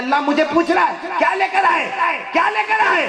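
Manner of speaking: very high, loud rising plea; impassioned cry. Acted. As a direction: cry out the question with rising fervour. Voicing the saint's fervent cry. (بلند جذباتی پکار)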